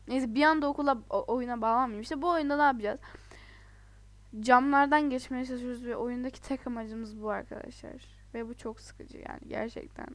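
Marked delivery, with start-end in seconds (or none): none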